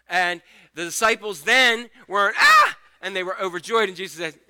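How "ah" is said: high, loud mock scream of fright; frightened cry. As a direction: cry out like someone seeing a ghost. Disciples' fright acted out.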